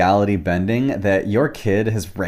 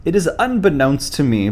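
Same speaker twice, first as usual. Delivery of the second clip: put-on English accent; 5 semitones higher than usual. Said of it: "I said in an English accent".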